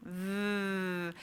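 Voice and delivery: "V" as a long buzzing vvv; voiced V sound. Shown as a demonstration. Teacher leading the second sound, sustained voiced V. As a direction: hold "V" buzzing, teeth on lip. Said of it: "turn your voice on".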